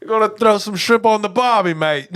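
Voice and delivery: hammed-up Aussie barbecue line; Australian accent. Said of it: "do Australian".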